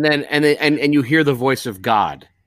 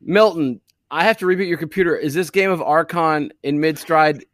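high, raised voice to the boss; mock-earnest shouted question; calling out; 7 semitones higher, 4 dB louder than usual. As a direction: call over to the boss, pitched up and pushy.

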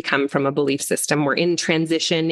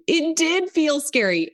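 put-on a child's voice, a little higher; child's small scared reply; 9 semitones higher than usual. Child tells the parent it felt scary, voiced higher. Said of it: "when they say".

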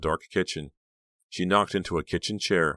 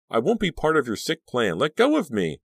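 high defiant outburst, growing louder; demanding; 9 semitones higher, 5 dB louder than usual. A narrator's reading. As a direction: refuse defiantly, building to a shout. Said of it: "Danielle demanded".

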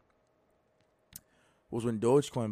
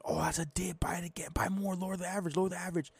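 whispered inner voice; 4 semitones higher, 8 dB quieter, faster than usual. Retold or imitated. Inner voice urging to buy dip.

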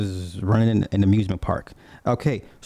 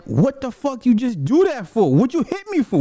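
mock indignation; 11 semitones higher than usual. Retold demand to the girlfriend who hit him, high and indignant. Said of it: "and I'm like".

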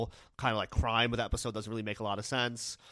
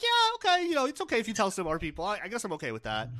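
much higher, fast, grudging okay; high reluctant Larry; 7 semitones higher, faster than usual. Retold or imitated. Larry grudgingly letting Kathy tell people, high and fast. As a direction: squeak out a reluctant yes, hurrying. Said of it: "It's like".

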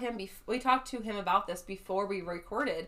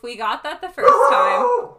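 exasperated; 8 semitones higher, 12 dB louder, slower than usual. Real, not acted. Scolding the barking dog.

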